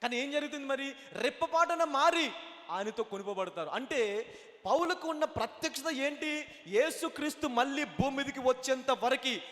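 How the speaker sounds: rising sermon fervour, ending very loud; full-force preaching. Real, not acted. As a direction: build to a loud, drawn-out pulpit climax. Preaching builds to climax.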